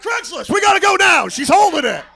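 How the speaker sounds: loud, high urgent shouting; frantic drunk friend. Retold or imitated. Drunk buddy rushing his friend to an alligator, frantic shout. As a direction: yell it like there's no time.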